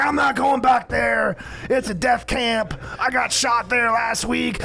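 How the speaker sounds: high, slow, drawn-out mock protest; protesting brother. Off-meds brother refusing to go back, high mock wail of protest. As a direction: imitate a panicked refusal, pitched high and stretched out.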